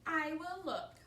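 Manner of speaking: high, loud storybook declaration; bold announcement. A narrator's reading. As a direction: announce it as Piggy. Piggy offers to check.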